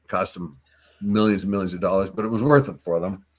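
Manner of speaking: ordinary talk, nothing marked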